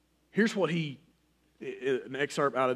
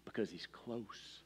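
much softer and slow, nearly breathed; hushed; 4 semitones lower, 13 dB quieter, slower than usual. Shown as a demonstration. Preacher hushes to show God's close whisper, soft. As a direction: lean in and say it softly.

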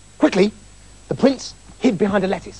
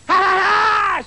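very high, shouted witch laugh; screeched cackle; 13 semitones higher, slower than usual. A narrator's reading. Witch greets the climbing prince, screeched triumphant cackle. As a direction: shriek a triumphant "ha-ha". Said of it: "she screeched".

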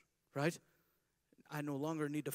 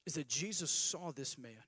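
ordinary delivery

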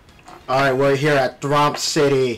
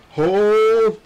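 hype countdown call; 6 semitones higher, slower than usual. Real, not acted.

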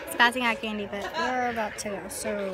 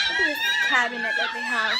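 excited; faster than usual. Real, not acted. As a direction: burst out with delight, high. Excited in uncle's house.

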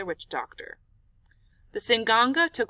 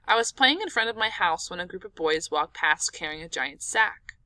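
plain reading voice

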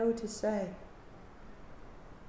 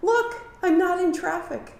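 much higher, with a lift of relief; bright declaration; 8 semitones higher, 4 dB louder than usual. Retold or imitated. Teacher voicing someone who has shaken off their bad mood, brightly. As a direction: announce it as good news. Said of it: "and express".

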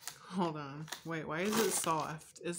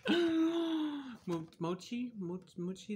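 surprised; 5 semitones higher, much slower than usual. Real, not acted. Discovers mochi in cereal.

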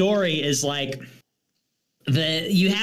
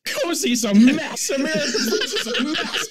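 mocking tweet; 8 semitones higher than usual. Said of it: "he's just like".

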